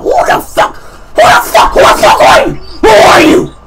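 high loud startled shout; yelling. Real, not acted. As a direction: shout it in disbelief. Unknown caller on phone.

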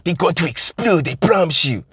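put-on high, foreign-accented terrorist sneer; a hammed-up foreign accent spitting at an American; mock foreign villain accent, pitched high; vague foreign villain accent. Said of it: "don't ask me where this, um, accent is from".

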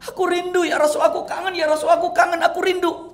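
high, loud, aching confession; tearful longing. Acted. Thauban embracing the Prophet, high tearful pleading. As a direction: pour out how much you missed him.

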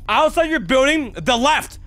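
high, loud confused yells; frantic shouting. Real, not acted. Player yelling confused directions at the game. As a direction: shout back in panic.

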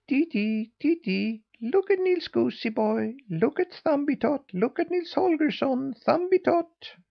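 very high, piping little bird voice; sparrow calling out. A narrator's reading. Sparrow calling out the boy to the yard, high chirping call. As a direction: chirp it out high on one steady note. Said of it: "before he called out".

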